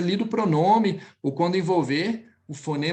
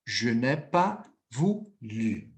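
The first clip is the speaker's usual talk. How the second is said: "je n'ai pas voulu" slow and lower, spaced out; slow lower teacher drill; slow model phrase; 6 semitones lower, slower than usual. Shown as a demonstration. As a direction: drill it slowly and low for the class. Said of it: "teacher models slowly".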